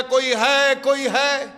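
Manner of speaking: loud, high call into the garden; calling out loudly. Acted. Young man calling for the garden's owner, loud high call. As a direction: shout to find anyone there. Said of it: "زور زور سے آواز دینے لگا".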